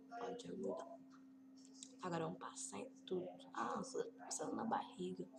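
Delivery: fast, hushed whisper. Real, not acted. Gamer concentrating on sterilizing the belly, whispering. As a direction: whisper it quickly as if not to disturb. Whispering during careful game step.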